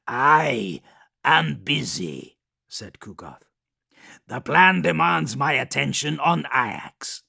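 loud, high blustering daemon; Kugoth voice. Acted. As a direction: bark back, busy and proud.

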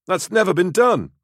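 very high, indignant insistence; incredulous protest. A narrator's reading. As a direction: push the pitch way up in disbelief. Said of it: "Natus protested".

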